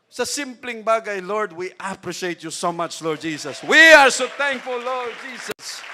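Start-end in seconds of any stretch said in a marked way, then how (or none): 1.2-5.8 an earnest thank-you prayer that peaks and then settles; fervent prayer. Pretend. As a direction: pray it out with rising fervour.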